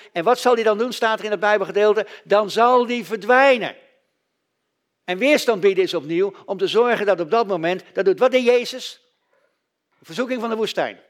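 normal talking voice

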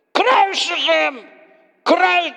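slow, high, loud mob cry; crowd screaming. Acted. The crowd screams for crucifixion, slow and loud. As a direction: hurl it like an angry mob. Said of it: "ze schreeuwden".

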